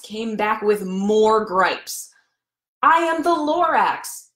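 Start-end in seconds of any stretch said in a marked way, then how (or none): 2.8-4.3 high Lorax voice. A narrator's reading.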